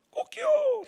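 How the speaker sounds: high squeaky rooster crow. Retold or imitated. Pastor imitating the rooster crowing at the denial, high and squeaky.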